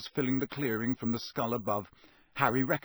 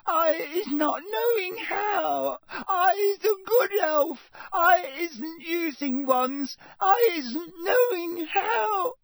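very high, slow crying squeal; terrified sobbing elf; 5 dB louder, slower than usual. A narrator's reading. Elf pleading to the official, crying in terror. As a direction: wail the denials in terror. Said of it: "her eyes rolling in terror".

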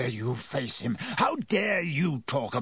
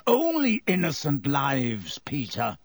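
plain reading voice

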